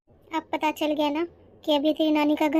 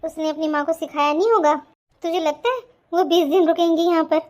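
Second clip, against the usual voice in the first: ordinary delivery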